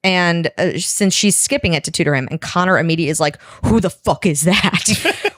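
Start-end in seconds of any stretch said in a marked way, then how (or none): 3.6-5.3 jealous outrage. Retold or imitated. Jealous character reacts to rival.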